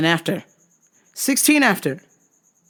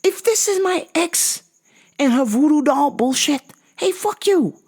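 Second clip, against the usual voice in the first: much higher, squeaky put-on voice; high-pitched mock fury; high squeaky rant; 9 semitones higher than usual. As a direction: squeak your accusation at the ex. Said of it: "And I was like".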